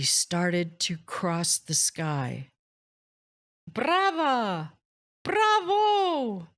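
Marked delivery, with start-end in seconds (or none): 3.7-6.5 crowd cheer. A narrator's reading. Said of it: "roared the crowd".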